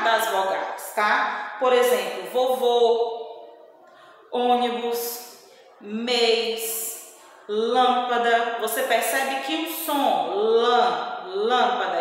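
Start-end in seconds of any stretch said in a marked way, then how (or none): 2.3-8.6 stressed, closed vowels in "vovô, ônibus, mês, lâmpada"; closed vowel sound. Shown as a demonstration. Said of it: "indica a sílaba tônica com o som fechado". 10.3-12.0 closed, nasal first vowel in "lã, lâmpada". Shown as a demonstration. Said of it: "é como se ele tivesse bem fechado".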